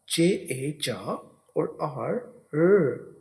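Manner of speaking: each sound drawn out very slowly. Shown as a demonstration. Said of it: "ये आपने याद रखना है".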